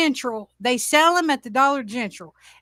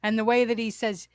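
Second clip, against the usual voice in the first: ordinary talk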